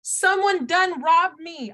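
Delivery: high, drawn-out Southern cry; hammed-up Southern accent from an old Western. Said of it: "a Southern, uh, like, a, an accent from, like, the old Westerns".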